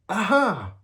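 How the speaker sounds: very high, loud "Aha!"; a gleeful shout of victory; triumphant cry. A narrator's reading. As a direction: crow it like you beat the trap. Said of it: "he cried triumphantly".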